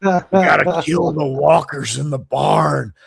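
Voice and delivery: loud, drawling tough-guy voice; exaggerated drawling TV-hero impression; Rick Grimes. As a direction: drawl it hard, barking the name and stretching the last words. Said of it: "He used to talk like this".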